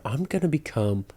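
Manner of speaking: ordinary talk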